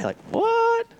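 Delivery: high incredulous what. Real, not acted. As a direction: toss it off in disbelief. Incredulous aside.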